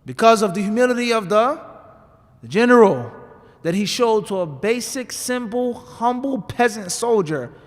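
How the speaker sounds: loud preacher drive, building on "the general"; preaching at full force. Acted. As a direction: hammer the lesson home to the congregation. Preacher driving his point home.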